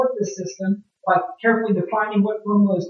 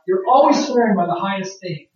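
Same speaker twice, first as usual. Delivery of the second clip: usual speaking voice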